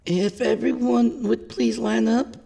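high, shaky female character calling out; high-pitched, nervous announcement. A narrator's reading. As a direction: call the crowd to line up, voice unsteady. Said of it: "her voice shaky".

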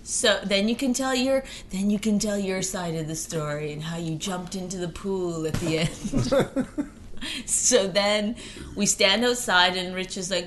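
usual speaking voice

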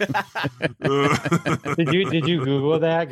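real laughing challenge; laughing question. Real, not acted. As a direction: laugh it out as you tease.

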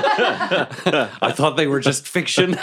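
laughing through the words. Real, not acted. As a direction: giggle while speaking slowly. Player laughing mid-line.

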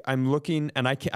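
ordinary delivery

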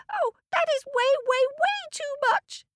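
very high squeak; squeaky ant. Acted. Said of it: "squeaked the ant".

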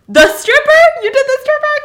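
very high, loud mock disbelief; shrill, hammed-up incredulous shout; shocked exclamation. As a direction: yell it in scandalized astonishment. Friend discovers the body, acted loudly.

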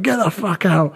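a hint of a shouting homeowner; imitated angry homeowner. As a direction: mimic the irate homeowner. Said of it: "They were like".

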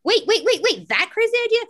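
high, loud disbelieving double-take; incredulous. Acted. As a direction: stammer it out high, aghast at the idea. Acted reaction to a wild idea.

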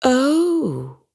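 high, worried gasp of a word. Acted. Birdwing reacts worriedly to the red butterfly, a worried oh. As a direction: caught off guard and concerned. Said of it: "a worried look crossing her face".

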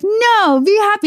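high-pitched, insistent pep; imitated upbeat friend. Positive friend cheering up a sad friend, mock-peppy. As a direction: urge cheer on them, way up high.

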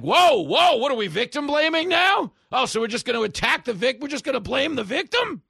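loud, high-pitched indignant protest; hammed-up accusatory yelling; mock outrage. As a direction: shout it like an offended objector. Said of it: "And they're like".

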